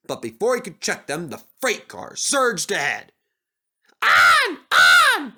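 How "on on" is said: much higher and louder wild cry. Acted. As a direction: urge each other on, yelling. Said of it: "they cried".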